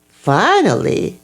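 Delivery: ordinary delivery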